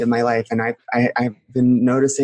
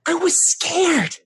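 scared, overwrought confession; 12 semitones higher than usual. Acted. Guest reliving the family being shrunk, distressed and scared. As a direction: plead your childhood hurt, pitched well up.

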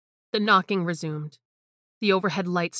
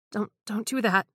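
pleading; 4 semitones higher than usual. Acted. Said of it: "I pleaded".

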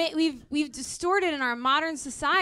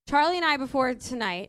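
ordinary talk

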